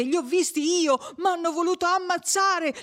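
high-pitched breathless, urgent report; panting, frightened boy. A narrator's reading. Menico tells how they tried to kill him, panting fright. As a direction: tell it in a high, scared rush. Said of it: "riprese Menico ansante".

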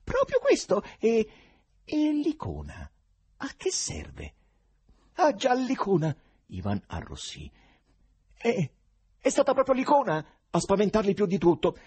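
voce acuta e concitata; poeta agitato. A narrator's reading. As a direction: insisti con foga, acuto e forte. Ivan parla dell'icona. (concitato, voce acuta)

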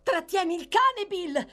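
high, loud urgent cry; shouted. A narrator's reading. Nancy begs Bill to hold back the dog, urgent shout. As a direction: shout it out in alarm. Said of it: "gridò Nancy".

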